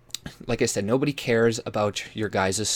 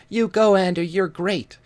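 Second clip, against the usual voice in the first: mock cheerleading; 9 semitones higher, 7 dB louder than usual. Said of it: "a few people like".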